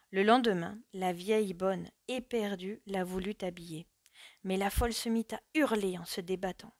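ordinary delivery, nothing marked

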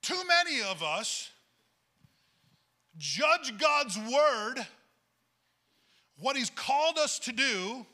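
slow and loud, leaning on every word; preacher challenges the congregation, slow emphatic preaching. Real, not acted. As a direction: drag it out and drive it home. Preacher's weighty point.

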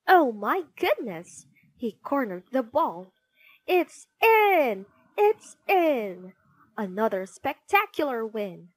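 live play-by-play building to a triumphant peak; excited football commentary. Acted. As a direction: call the match as the winning goal goes in.

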